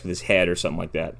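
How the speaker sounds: normal talking voice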